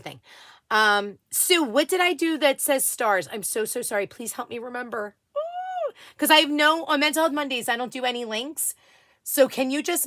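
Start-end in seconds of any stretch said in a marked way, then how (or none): none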